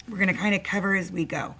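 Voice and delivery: ordinary delivery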